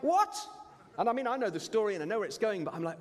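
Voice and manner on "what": high, loud disbelieving what; shocked what. Retold or imitated. Moviegoer reacting to the cliffhanger ending, high shocked yelp. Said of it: "And I'm like".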